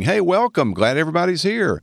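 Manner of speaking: high, sing-song mock church greeter; exaggerated high-pitched hello to everybody; Sunday morning greeting. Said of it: "at the Sunday morning greeting".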